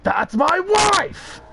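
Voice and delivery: high, slow screaming anime rage; Vegeta scream. Shown as a demonstration. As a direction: scream it like a furious anime prince. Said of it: "do the "That's my wife" scream in the Vegeta voice".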